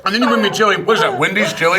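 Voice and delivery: loud, indignant ranting; mock outraged rant. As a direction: blow up in comic outrage.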